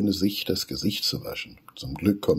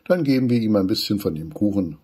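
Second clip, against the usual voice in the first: usual speaking voice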